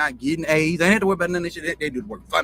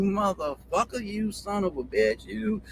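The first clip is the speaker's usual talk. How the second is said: slow, stretched Southern country drawl; a country kid cussing slow and drawn out; hard country drawl; 4 semitones higher, slower than usual. Retold or imitated. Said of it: "But it be in a country drawl like".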